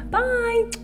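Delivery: very high playful farewell; squeaky bye. Real, not acted. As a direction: chirp it out. Silly sign-off.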